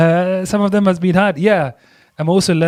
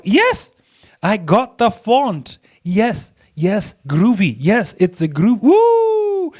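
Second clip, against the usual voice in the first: high-pitched delighted celebration, ending in a whoop; excited; 6 semitones higher, much slower than usual. Real, not acted. Designer finding the font she wanted, really excited. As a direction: cheer as if you've struck gold. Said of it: "Look at me, so excited".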